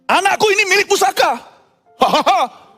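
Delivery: proud boasting father. Acted. Father boasting son to parents.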